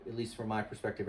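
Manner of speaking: usual speaking voice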